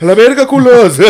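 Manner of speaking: high, loud rebel shout; rowdy punk yell. Retold or imitated. Punk yelling, mocking quote.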